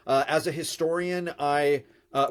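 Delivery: ordinary delivery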